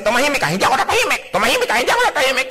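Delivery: fast, high back-and-forth bickering; mock quarrel. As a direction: bicker it out quickly. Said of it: "Pero kadalas kasi". (mabilis na pag-aaway)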